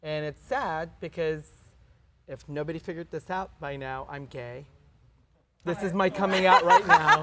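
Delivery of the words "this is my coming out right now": laughing. Real, not acted. Joking coming out.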